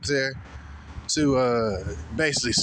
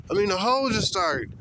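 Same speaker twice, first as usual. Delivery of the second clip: normal talking voice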